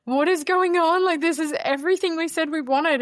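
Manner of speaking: high, loud tearful exclaim; almost crying with disbelief; pointing outside, high and loud with emotion; overwhelmed disbelief. Retold or imitated.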